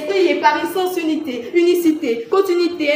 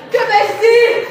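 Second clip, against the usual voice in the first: high, loud declaimed question; dramatic raised-voice appeal; theatrical outcry; 7 semitones higher, 8 dB louder than usual. Acted. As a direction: cry the question out to the hall. Rhetorical outcry in contest speech.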